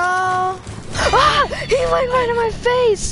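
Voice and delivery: high scared cry; startled scream. Real, not acted. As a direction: shriek as he's grabbed. Killer jumps him.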